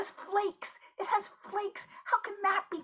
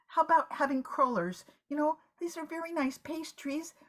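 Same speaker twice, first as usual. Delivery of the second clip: ordinary talk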